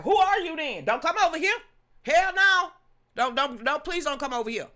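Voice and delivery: high, loud, shooing someone off; hammed-up no way; mock outraged refusal. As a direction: shout them away from the door. Mock refusing a party switcher.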